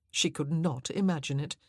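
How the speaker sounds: usual speaking voice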